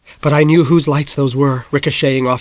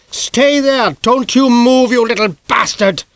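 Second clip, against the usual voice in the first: high furious yell; angry shout; 10 semitones higher, slower than usual. A narrator's reading. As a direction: bark the order at the boy. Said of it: "Doctor Lysander shouted".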